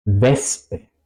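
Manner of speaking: "Wespe" with a plain S before the P; S and P separate. Shown as a demonstration. Teacher showing SP inside a word, "Wespe" with separate sounds. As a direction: say "Wespe" as ves-pe, no sh. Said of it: "se pronuncia por separado".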